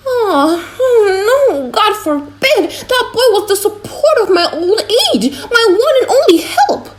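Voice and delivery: high cracking wail of grief; an old man crying out that his boy is gone; old father wailing. Acted. Old father hears son dead.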